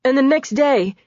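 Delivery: a cornered man spilling everything; frightened confessor. A narrator's reading. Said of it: "pleading for his life".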